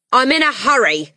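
loud, rushed dad voice; impatient father. A narrator's reading. Father hurrying to catch plane, impatient and loud. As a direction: brush them off, loud and impatient.

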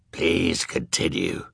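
slow, low hoarse judge. A narrator's reading. A judge who can hardly talk, hoarse. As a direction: rasp it as if screamed out for hours. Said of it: "This one was very hoarse".

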